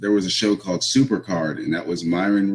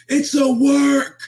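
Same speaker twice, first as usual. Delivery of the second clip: high, drawn-out mock shout; stand-up-and-yell heckle, stretched slow and very high; imitated yell; 6 dB louder, much slower than usual. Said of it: "stands up and he yells".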